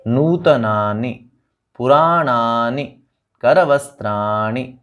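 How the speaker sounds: slow, with a light stress before the final i of नूतनानि, पुराणानि, करवस्त्राणि; careful slow model words with a short final i. Shown as a demonstration. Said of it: "पिछले स्वर पर थोड़ा सा ध्यान रखेंगे".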